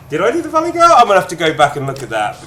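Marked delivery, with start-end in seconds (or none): 0.1-0.9 high, loud disbelieving question; incredulous. Real, not acted. Streamer asks viewers about the Valley Girl voice, incredulous. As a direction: blurt it out much higher and louder.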